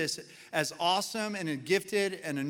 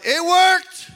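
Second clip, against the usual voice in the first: a crafty dad gloating that his trick worked; triumphant yell; 12 semitones higher, 13 dB louder, slower than usual. Retold or imitated. Dad hangs up on his kids and yells in triumph, laughing. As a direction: shout it out high and loud, bursting into a laugh. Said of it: "and he yelled out".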